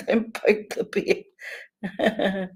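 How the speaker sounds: drawn-out teasing warning; slow mock warning. Shown as a demonstration. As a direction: stretch each word out as a playful warning. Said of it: "I always say to people".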